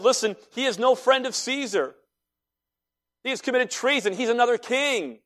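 high-pitched pressing crowd accusation; insistent accusation. Retold or imitated. Crowd accuses Jesus before Pilate.

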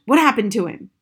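loud, startled question; alarmed splutter. A narrator's reading. Father jumping at daughter's glue story, spluttering. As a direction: burst out in sudden alarm. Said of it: "he spluttered".

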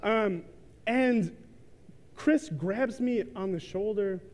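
ordinary talk, nothing marked

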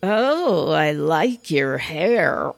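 slow, drawn-out sarcasm; sarcastic compliment. Shown as a demonstration. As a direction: say it with an eye roll, dragging the words. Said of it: "And roll my eyes".

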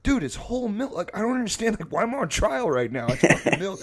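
high, loud mock-indignant self-defence; exasperated protest. Friend defends his milk to angry roommate, acted exasperation. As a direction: plead your case in acted disbelief.

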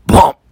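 sudden loud imitated bang. Storyteller imitating a bang on the door, loud burst. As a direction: burst out one explosive bang noise. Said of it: "then came one big bang".

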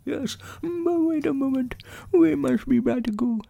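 very high, soft, earnest little bear; thin-voiced toy bear giving careful instructions; Winnie the Pooh. A narrator's reading. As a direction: explain a plan softly in a small, high voice.

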